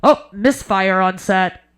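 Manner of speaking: loud, slow, laughing PA voice; a dry PA announcement, slowed; director on the mic. Retold or imitated. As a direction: drawl it out over the loudspeaker. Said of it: "She just goes".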